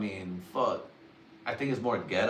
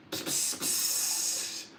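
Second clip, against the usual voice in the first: drawn-out voiceless "pss" bursts; hissing piss noise; 4 dB quieter, much slower than usual. Acted. Golden shower joke, hissed spray noise. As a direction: hiss it like a striking cobra. That could have been a piss or a cobra strike.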